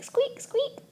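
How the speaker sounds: high, soft squeaking noises; squeaks. A narrator's reading. Hamster heard only as squeaks, high squeaking.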